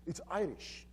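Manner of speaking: plain reading voice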